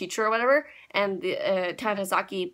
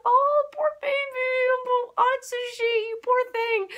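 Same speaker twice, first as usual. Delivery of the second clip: cooing sympathy; 14 semitones higher than usual. Retold or imitated. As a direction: coo like comforting a crying baby. Pitying child hero.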